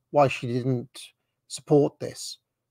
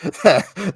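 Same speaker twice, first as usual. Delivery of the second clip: burst of laughter; 5 semitones higher, 6 dB louder than usual. Real, not acted. Reacting to the Reagan-Thatcher call.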